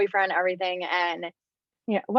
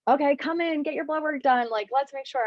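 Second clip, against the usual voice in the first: higher-pitched mock coworker voice; imagined work colleague; 7 semitones higher than usual. Imagining work's reply to her news, higher mock colleague. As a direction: chirp instructions like an imagined colleague. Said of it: "They're gonna be like".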